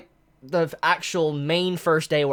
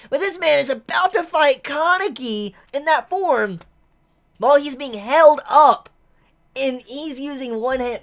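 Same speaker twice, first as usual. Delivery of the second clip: higher and louder, fired-up commentary; hyped up; 9 semitones higher, 6 dB louder than usual. Real, not acted. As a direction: sell how epic it is. Hyped about character fighting.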